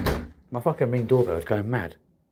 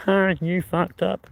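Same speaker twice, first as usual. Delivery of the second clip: high, slow mocking taunt; mocking jeer; 10 semitones higher, slower than usual. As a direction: jeer it like a gloating viewer. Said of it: "then you'll be like".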